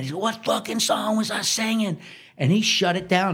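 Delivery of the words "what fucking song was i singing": pissed demand. Retold or imitated. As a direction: lightly imitate a furious star grilling the drummer. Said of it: "he's pissed and he's not having it".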